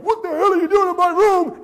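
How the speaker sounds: high, loud outraged yell; furious yell. Retold or imitated. Man woken in bed yells at a naked stranger, furious. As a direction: explode in shock at an intruder. Startled guest yells at intruder.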